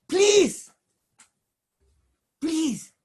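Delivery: loud, high double please; desperate pleading. Acted. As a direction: beg with everything left. Moshe pleading with God.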